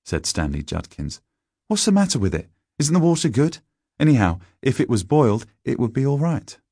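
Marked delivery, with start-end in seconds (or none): 1.7-6.6 high schoolboy voice, challenging; argumentative schoolboy. A narrator's reading. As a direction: argue back as a boy, pitched up. Narrator voices Stanley.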